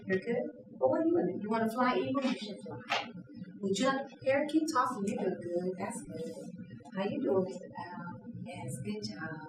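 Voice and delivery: very soft, soothing murmur; calm everyone down, barely above a hush; gentle dove voice. Acted. Trainer voicing the peacemaking dove, soft and soothing. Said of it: "The dove is in the tree going".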